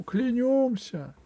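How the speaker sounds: high, slow echoing vow; crowd oath echo. A narrator's reading. Crowd repeating the oath, high echoing vow. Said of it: "прокатилось по залу".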